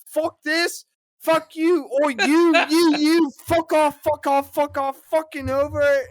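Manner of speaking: high, loud, repeated cursing; hammed-up furious shouting; mock angry outburst. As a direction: imitate a coworker losing it at everyone. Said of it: "a lot of anger".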